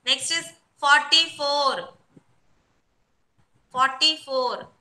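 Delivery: number drawn out slowly for the class; slow dictation. Real, not acted.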